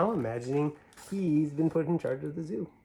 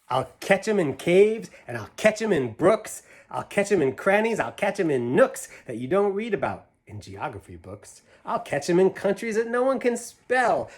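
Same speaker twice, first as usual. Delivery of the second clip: higher, louder, bouncy rhyme reading; reading to children; 6 semitones higher, 6 dB louder than usual. Acted. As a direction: read it big and playful for a child. Reads picture book aloud.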